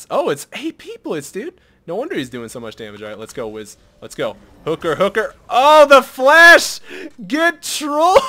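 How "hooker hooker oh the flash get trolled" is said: high, loud, drawn-out taunt; gloating shout. Real, not acted. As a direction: crow over the enemy's flop. Enemy misplays, gloating shout.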